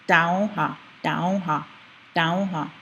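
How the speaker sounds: "daoha" as DAU-ha with a clear d; D sound substitute. Shown as a demonstration. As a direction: drill "daoha", tongue behind the teeth. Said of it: "practice it with a D sound".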